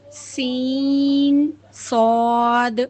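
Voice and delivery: سین and صاد each held for four counts; four-count letters. Shown as a demonstration. Teacher demonstrates the four-count length for students. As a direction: hold سین then صاد long and even. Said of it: "four count".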